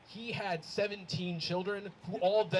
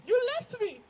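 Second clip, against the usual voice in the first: high, louder grandma complaint; mock grandma; 7 dB louder than usual. Grandma scolding him in the car, high mock whine.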